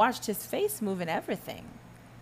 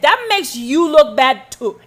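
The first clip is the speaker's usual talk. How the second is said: usual speaking voice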